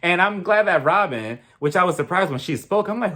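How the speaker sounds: ordinary delivery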